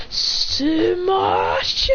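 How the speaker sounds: high, stretched, strained battle cry; hammed-up anime finishing yell; attack yell. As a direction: draw the word out as the punch lands. Acted punch attack call.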